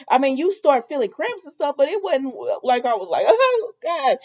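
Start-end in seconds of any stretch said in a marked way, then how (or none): 3.3-4.2 mock agony. Acting out how labor felt.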